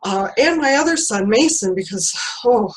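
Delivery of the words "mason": usual speaking voice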